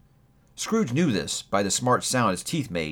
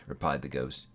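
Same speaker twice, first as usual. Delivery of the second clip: plain reading voice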